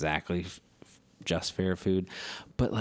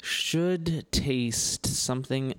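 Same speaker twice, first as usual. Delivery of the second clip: slow teasing; 4 semitones higher, much slower than usual. Real, not acted. Teasing the drink.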